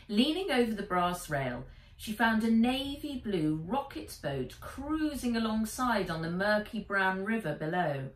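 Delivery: plain reading voice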